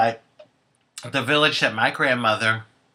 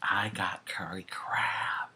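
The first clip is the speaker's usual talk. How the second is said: whisper; 4 semitones lower, 10 dB quieter, slower than usual. Real, not acted. Whispering about the food.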